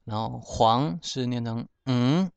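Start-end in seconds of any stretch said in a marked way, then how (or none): 1.9-2.3 嗯 as a syllabic nasal "ng" on a rising tone; pure nasal. Shown as a demonstration. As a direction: hum 嗯 as Hokkien "n̂g", no vowel. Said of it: "纯粹的鼻音".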